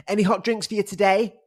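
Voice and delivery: high, sing-song server voice; high chirpy staff voice; imitating the drinks guy. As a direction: mimic the cheery counter guy. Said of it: "Like that".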